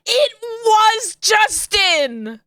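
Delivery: high, slow, laughing shout; excited shout. Real, not acted. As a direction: yell it in triumph. Delighted recognition shout.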